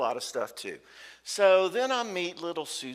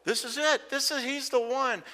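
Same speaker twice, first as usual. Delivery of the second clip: higher, gushing and thrilled; higher swooning mock excitement; excited girlfriend; 11 semitones higher than usual. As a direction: gush like she's found the one. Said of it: "And she's like".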